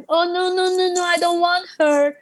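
hammed-up pleading protest. Acts out refusing the pastor's wife.

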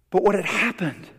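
ordinary talk

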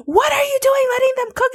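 high-pitched mock scolding; hammed-up angry mom; imitated mom yelling.

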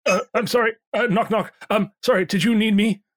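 high, flustered call from the kitchen; flustered innkeeper. Acted. Pierre at the door.

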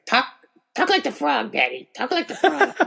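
put-on high squeaky little kid voice; imitating a small child begging; toddler voice. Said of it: "she'll just be like".

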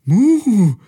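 very high, loud shocked cow moo; gasping moo. A narrator's reading. The cow Carola falls into the pool, a shocked gasping moo. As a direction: moo as the cold water hits you. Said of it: "Carola japste nach Luft".

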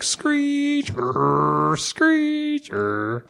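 high squealing screech, twice over; tire screech imitation. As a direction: mimic tires screeching round a bend. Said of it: "I could hear you as you drive away".

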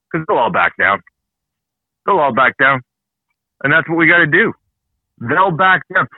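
ordinary delivery

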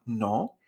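"No" lifted straight upward; simple question rise. Shown as a demonstration. Teacher explaining second tone as a question, rising "No". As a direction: ask "No" with a plain upward glide. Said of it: "pregunta, pero simplemente hacia arriba".

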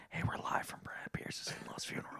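mock funeral broadcast in a hushed whisper. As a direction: breathe it like a reporter at a graveside. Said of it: "whisper into 'em".

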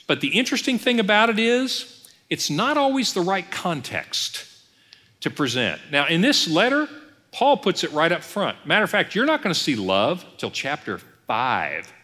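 normal talking voice

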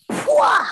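loud, high imitated slap; slap noise. Aunt imitating slapping nephew, loud slap noise. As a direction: smack the air with a sound.

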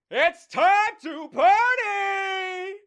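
high, slow, booming party yell; wild god crashing a funeral; party shout. Acted. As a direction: announce the party with a huge shout.

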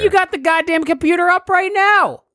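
mock indignant. Real, not acted. Complaining friend has computer.

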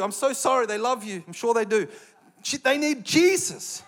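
usual speaking voice

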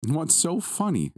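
high, drawn-out playful question; amused query. A narrator's reading. Dad questions his giggling son, playfully puzzled. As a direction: ask slowly with a big lift in pitch.

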